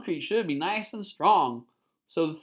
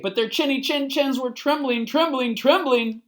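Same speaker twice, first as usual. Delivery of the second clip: trembling; 8 semitones higher, 4 dB louder than usual. A narrator's reading. Wolves are scared.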